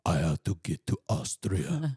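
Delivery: mock Austrian action-hero accent; Arnold-style Austrian accent; lightly done Austrian accent.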